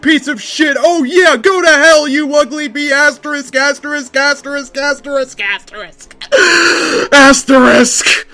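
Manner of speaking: high, loud battle scream at the enemy; furious yell. Acted. Hero screaming at Chaos in the final battle, furious yell. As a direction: scream it out with everything you have. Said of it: "I yelled".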